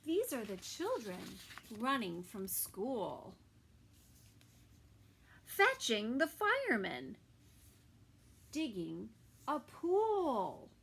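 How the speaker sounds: put-on lively storybook reading for kids; reading to children. A narrator's reading. Narrator reading a story to children, lively. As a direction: read it like a bedtime picture book. Reading picture book aloud.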